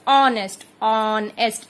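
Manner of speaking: ऑनेस्ट, ऑनेस्ट with the vowel first; silent h. Shown as a demonstration. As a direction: say ऑनेस्ट twice, no h. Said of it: "इस तरह से". ("ऑनेस्ट" दो बार, बिना एच)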